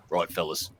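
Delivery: deep, soft, broad Australian bloke; a gruff Australian Prime Minister talking to soldiers, broad Australian accent. Retold or imitated. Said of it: "He says".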